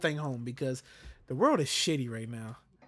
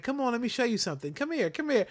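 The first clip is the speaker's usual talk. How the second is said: put-on higher, eager little girl tugging; eager daughter; 8 semitones higher than usual. Father mimicking his daughter eager to show him something, higher. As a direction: act out a toddler pulling dad along, higher. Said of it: "so she grabs my hand".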